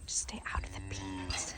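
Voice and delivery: whispered warning to the animals. Real, not acted. Whispering to the goats, stay out of the beans. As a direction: hush it, just breath.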